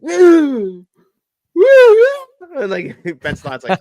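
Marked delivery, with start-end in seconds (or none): 0.0-2.6 shrill high whooping, much louder; mock manic whooping; high whoops. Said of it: "and he's like".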